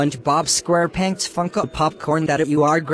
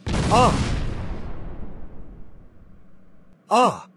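startled scream; 7 semitones higher, 3 dB quieter than usual. Acted. Waking from bad dream.

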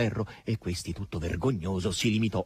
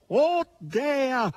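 high, long howl; howling pig voice; howling boar. A narrator's reading. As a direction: howl like a desperate hog. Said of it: "ululò questi".

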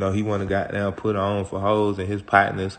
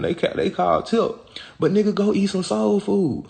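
heated rant; 10 semitones higher than usual. Real, not acted. Ranting, worked up.

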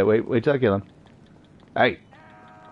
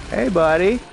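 mock friendly call; 7 semitones higher, 3 dB louder than usual. Taunting enemy in game.

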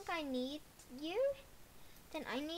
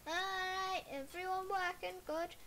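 normal talking voice

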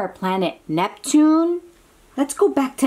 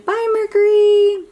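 high, louder bye to the planet; cheery goodbye; 12 semitones higher, 6 dB louder than usual. Acted. Child's reading, high cheery goodbye to Mercury. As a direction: wave it off brightly.

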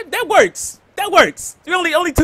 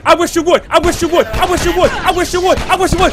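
taunting repeat; 5 semitones higher, 6 dB louder than usual. Real, not acted.

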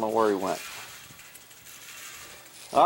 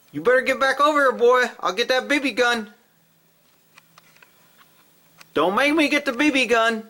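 calling threat; 11 semitones higher than usual. Real, not acted. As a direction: threaten him back across the yard. Threatening cat from distance.

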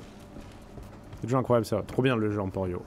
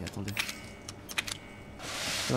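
ordinary delivery

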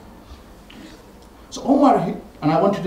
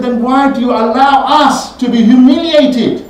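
loud, higher and slow; bitter outcry; 9 semitones higher, 9 dB louder, slower than usual. Retold or imitated. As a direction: drag out the hurt and anger. Omar's bitter complaint.

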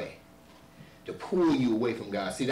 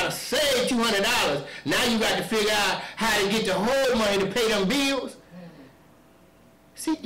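loud, high-pitched sermon cadence; fiery preaching; 11 semitones higher, 6 dB louder than usual. Acted. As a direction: preach it hard to the congregation. Preaching at full force.

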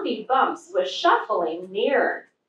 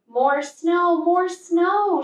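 high, a bit louder chant; eager shout; 8 semitones higher, 3 dB louder, slower than usual. A narrator's reading. Little Bear shouts while packing snow, eager chant. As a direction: call for snow as you pack. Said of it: "shouted Little Bear".

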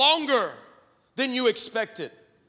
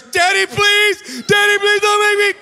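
put-on high, loud, panicked child begging; crying plea; 10 semitones higher, 9 dB louder than usual. Daughter begging dad before going on air, mock crying plea. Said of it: "Crying, shaking".

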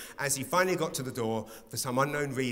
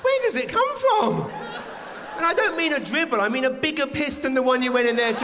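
much higher and louder, laughing outrage; outraged shout; 11 semitones higher, 4 dB louder than usual. Real, not acted. Comic telling the crowd his body's betrayal, outraged shouting. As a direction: yell it like a betrayal.

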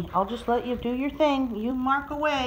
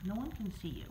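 usual speaking voice

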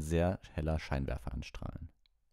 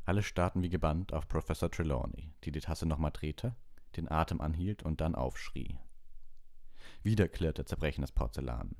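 ordinary delivery, nothing marked

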